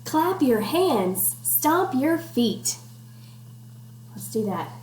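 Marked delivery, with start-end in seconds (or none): 0.1-2.8 slow rhythmic rhyme reading; sing-song read-aloud. Acted. Teacher reading rhyme to class, slow sing-song. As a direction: bounce the rhyme for kids. Reading picture book to children.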